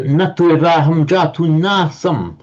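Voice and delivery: whole line "na tu eva aham jatu nasam" lifted, stress on "tu"; emphatic negative. Shown as a demonstration. Teacher rereading the full verse line, emphatic. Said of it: "just take that as a very emphatic negative".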